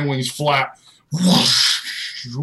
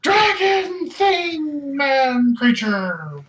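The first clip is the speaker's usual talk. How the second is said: high, drawn-out villager's wail; hammed-up high shriek of alarm; panicked townspeople; 12 semitones higher, much slower than usual. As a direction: cry it out like a terrified mob. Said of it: "You see people".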